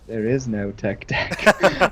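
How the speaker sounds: slow, solemn mind-trick line; student waving off the teacher, slow and grave; deadpan Jedi. Retold or imitated. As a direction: say it slowly like a hypnotic Jedi. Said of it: "and he goes".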